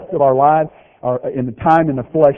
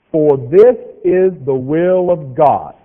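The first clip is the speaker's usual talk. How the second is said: very slow, louder, each word laid out; read real slow; 6 dB louder, much slower than usual. Shown as a demonstration. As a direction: spell it out slowly for the slow learners. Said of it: "So I'm gonna read this one real slow".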